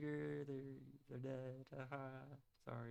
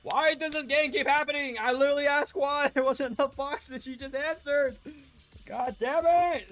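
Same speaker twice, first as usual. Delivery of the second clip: exasperated outburst; 10 semitones higher, 6 dB louder than usual. Real, not acted. Exasperated at game twist.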